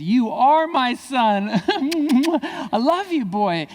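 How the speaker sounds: slow, high, laughing declaration; a father proud of his son, slow and laughing. Retold or imitated. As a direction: beam with pride through laughter.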